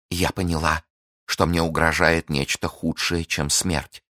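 plain reading voice

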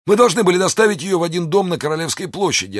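very high, strained character voice; wounded soldier. A narrator's reading. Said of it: "отвечал раненый".

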